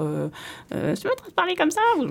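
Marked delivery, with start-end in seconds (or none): 1.0-1.8 squeaky high put-on voice; high silly voice. Shown as a demonstration. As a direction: pitch way up, cartoonish. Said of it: "passer d'une voix à l'autre".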